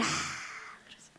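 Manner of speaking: breathy trailing whisper. Real, not acted. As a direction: let the words fade to a whisper. Jesus' voice retold.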